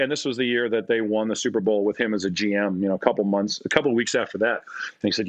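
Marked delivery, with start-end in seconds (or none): none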